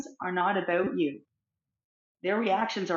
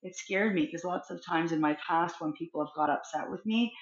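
ordinary talk

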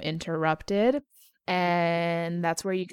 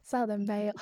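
Southern belle; 3 semitones higher, 4 dB quieter, faster than usual. Acted. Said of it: "be a little Southern belle".

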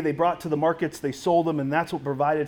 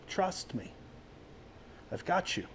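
much softer, hushed and reassuring; tender reassurance; 13 dB quieter than usual. Acted. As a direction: hush it down like comforting a frightened friend. Jesus reassuring someone clinging to sin.

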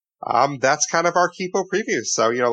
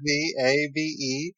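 slow, careful letter by letter; spelled out slowly; slower than usual. Shown as a demonstration. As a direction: spell each character out. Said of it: "Just to say it one time".

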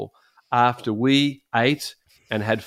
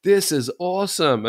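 eager delight; 7 semitones higher than usual. Retold or imitated. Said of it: "And I was going".

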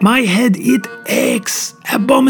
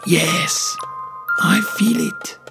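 put-on softer, brooding accented detective; muttered Poirot; 7 dB quieter, slower than usual. A narrator's reading. Detective feeling evil in the air, muttering softly. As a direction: murmur it to yourself, sensing evil. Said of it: "muttered Poirot".

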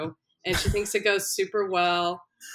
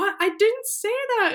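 mock shocked sob; 4 dB louder than usual.

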